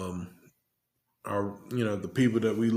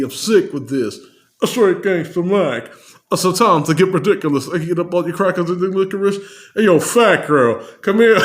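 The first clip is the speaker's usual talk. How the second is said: higher, louder playful rap voice; 5 semitones higher, 5 dB louder than usual. Retold or imitated. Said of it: "impersonate the voice".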